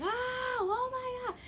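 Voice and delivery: high, drawn-out scream of fright at a spider; startled scream. Retold or imitated. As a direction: shriek like you just saw a spider. Spider in the apartment.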